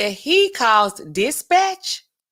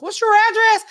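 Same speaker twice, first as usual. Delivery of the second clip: sweet mock cop; 12 semitones higher, 5 dB louder than usual. Playing along with caller.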